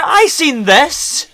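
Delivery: high, slow disbelieving cry; shocked realization. Real, not acted. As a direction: gasp it out in disbelief. Realizes he fell for it.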